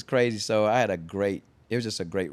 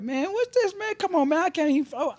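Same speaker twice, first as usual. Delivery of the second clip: high, louder exasperated rapper voice; 4 dB louder than usual. Retold or imitated. Tip complaining about the wrong record, high exasperated imitation. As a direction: whine that the beat's wrong. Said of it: "I like to imitate people".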